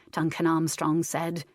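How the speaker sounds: ordinary delivery, nothing marked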